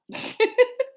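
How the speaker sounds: a high giggle; high laugh. Real, not acted. Remembering a flashback, a high giggle.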